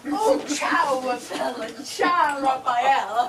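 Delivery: a lightly put-on Italian flourish on ciao, Raphael; airy Continental greeting with a laugh; mock European greeting. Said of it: "wasn't that European of me?".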